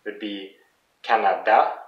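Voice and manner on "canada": French "Canada", weight on final "da"; last-syllable stress. Shown as a demonstration. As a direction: demonstrate "Canada" stressed at the end. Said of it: "it's the last part that is the most emphasized".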